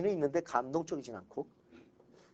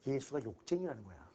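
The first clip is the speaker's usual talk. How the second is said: normal talking voice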